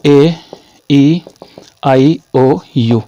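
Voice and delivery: slow, separated A, E, I, O, U; teacher sounding out "ए ई आई ओ यू"; vowel drill. Shown as a demonstration. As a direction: give each vowel its own beat and mouth shape. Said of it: "मुंह की shapes को".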